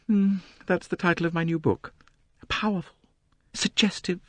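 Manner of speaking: high, complaining society novelist. A narrator's reading. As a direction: complain grandly about the crowd. Mrs Otterbourne continues.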